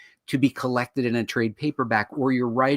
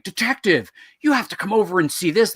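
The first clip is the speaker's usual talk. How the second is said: higher-pitched mock cop-show call; urgent TV summons; 7 semitones higher, 4 dB louder than usual. Officer summons a detective to the crime scene, mock urgent.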